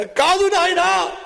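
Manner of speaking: high, loud, slow crying no; tearful denial. Retold or imitated. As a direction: drag it out through sobs. Mourner denies through crying. (ఏడుస్తూ నెమ్మదిగా కాదనడం)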